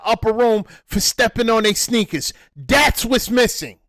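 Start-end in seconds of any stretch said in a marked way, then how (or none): none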